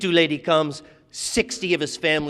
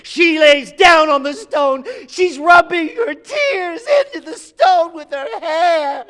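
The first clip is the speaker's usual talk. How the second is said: crying; 13 semitones higher, 7 dB louder, much slower than usual. Acted. Narrator overcome, retelling the lament.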